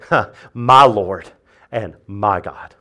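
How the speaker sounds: low, slow, soft awe; awed confession. Acted. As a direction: breathe it out in wonder. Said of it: "but say".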